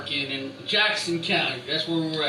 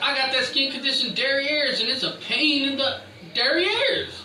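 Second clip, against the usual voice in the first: high comic voice; 8 semitones higher than usual. Pretend. Comic pitch rise on joke.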